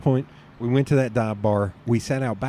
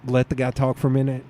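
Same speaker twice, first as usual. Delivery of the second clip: ordinary talk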